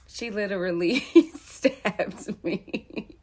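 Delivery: laughing through the words; chuckling while telling it; amused disbelief, laughing. Real, not acted. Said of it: "I could laugh about it now".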